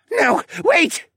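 very high, loud plea; panicked begging. A narrator's reading. Assassin begs as he is dragged away, panicked. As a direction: beg in a panic. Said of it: "he begged".

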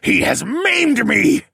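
long, high, loud wail. A narrator's reading. Maimed lord held back by his brother, wailing. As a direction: wail it out in outraged pain. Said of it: "he wailed".

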